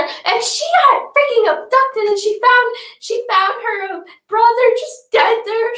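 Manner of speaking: excited retelling. Retold or imitated. Dramatic retelling of scary show.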